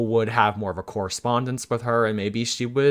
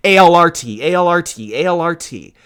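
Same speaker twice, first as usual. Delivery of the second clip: loud, insistent mock chant, pitched up; higher, louder repeated demand that eases off; mock pestering; 5 semitones higher, 7 dB louder than usual. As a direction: imitate fans poking her, punching out each letter. Imitating pestering fans poking for answers.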